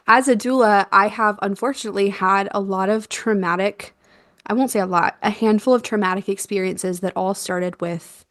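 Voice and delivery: usual speaking voice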